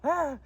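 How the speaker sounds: loud choked gasp; a sudden loud catch of feeling; loud emotional ah. Real, not acted. Emotional outburst while retelling.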